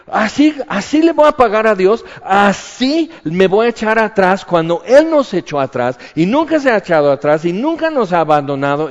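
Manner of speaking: high and loud, fast at the end, defiant; angry declaration. Retold or imitated. Preacher retelling his furious answer to the devil, defiant shout. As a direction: hurl it back with righteous anger. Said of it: "O sea, me enojé".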